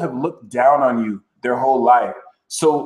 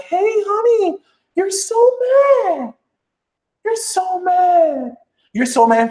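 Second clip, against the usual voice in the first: very high, drawn-out teasing singsong; taunting sing-song. Pretend. To those whose lies failed, a high mocking singsong. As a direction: stretch every word to rub it in. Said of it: "look at 'em and be like".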